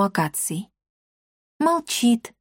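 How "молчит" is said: put-on high, girlish agreement; agreeing girl. A narrator's reading. Girl agreeing with the cat about his silence, girlish. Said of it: "согласилась Дарина".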